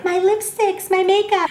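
put-on high little-girl voice clutching her treasures; childish possessive voice. Toddler hoarding chapsticks, high possessive little voice. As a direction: squeak it like a toddler guarding lipsticks.

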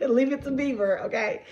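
high laugh through the words. Real, not acted. Telling viewers the irony, laughing high. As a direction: laugh it out, tickled. Laughing at her own story.

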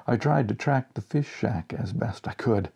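ordinary delivery, nothing marked

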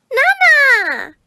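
very high, loud delighted cry; excited child's call. Acted. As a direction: call out to grandmother at the top of a child's voice. Girl runs to her grandmother.

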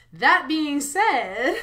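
slow, loud, theatrical pivot; dramatic turn. Pretend. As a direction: deliver it like a big dramatic reveal. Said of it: "I had to do the dramatic turn".

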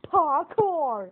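loud, drawn-out game exclamation; calling out. Real, not acted. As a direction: shout it as a big announcement. Announces the nightmare, calling out.

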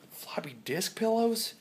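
whispered, slow and high question; whispered idea. Real, not acted. Vlogger musing about selling pillows, whispered. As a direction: breathe it as a secret idea.

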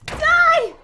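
high, loud one-word battle cry; attacking yell. Real, not acted. Player attacking her rival in a deathmatch, loud yell. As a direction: yell it as you strike.

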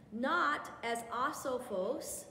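"asophos" said slowly, each syllable drawn out; careful Greek pronunciation. Shown as a demonstration. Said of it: "try really hard to pronounce these words correctly".

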